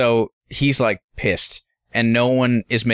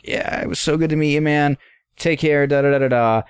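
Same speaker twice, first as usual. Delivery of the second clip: high, over-friendly farewell; retold breezy goodbye; 4 semitones higher than usual. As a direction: mimic polite small talk while backing out. Polite goodbye while escaping.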